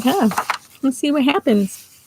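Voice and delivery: ordinary delivery, nothing marked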